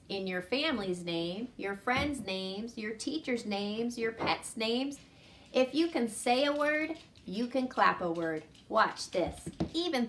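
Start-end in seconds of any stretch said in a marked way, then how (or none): none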